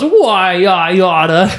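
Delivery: high cartoon sailor threat; mock cartoon bluster breaking into a laugh; Popeye. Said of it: "from Popeye".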